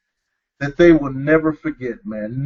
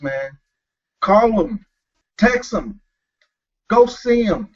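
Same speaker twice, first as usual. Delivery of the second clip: higher, rising exhortation building in urgency; fired-up preaching; 5 semitones higher than usual. Acted. Host urging absent fathers to call their kids, fervent preaching. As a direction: preach it, push the fathers to act now.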